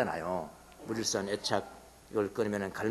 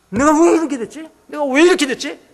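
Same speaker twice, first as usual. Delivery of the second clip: higher, louder, alarmed at oneself; indignant self-questioning; 12 semitones higher, 8 dB louder than usual. Acted. Believer voicing outrage at evil planted in the heart, louder. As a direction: shake yourself awake with the question. Inner self-reproach voiced loudly.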